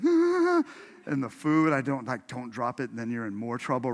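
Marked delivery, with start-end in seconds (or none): 0.0-0.6 high, loud frightened Ah; scared yelp. Retold or imitated. Boy grabbed by the neck, high scared Ah. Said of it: "I just was like".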